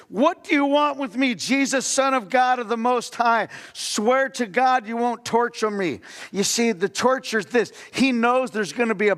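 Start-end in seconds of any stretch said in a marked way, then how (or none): 0.1-6.0 a raised, higher pleading cry to Jesus; shouted plea. Acted. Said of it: "He shouted at the top of his voice".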